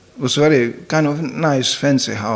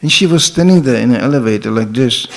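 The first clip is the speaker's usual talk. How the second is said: ordinary talk, nothing marked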